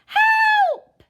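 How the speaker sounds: very high, loud scream for help; a shrieking cry for help; terrified shout. A narrator's reading. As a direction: scream it in panic. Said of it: "the other one cried".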